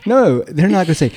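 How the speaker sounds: normal talking voice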